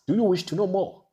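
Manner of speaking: 훨씬 높고 크게 다그치듯 묻기; emphatic rising question. Acted. Same question repeated, much higher.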